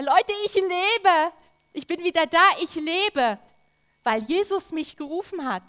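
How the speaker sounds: shouting for joy; joyful shout. Pretend. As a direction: call it out to everyone, overjoyed. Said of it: "rief er".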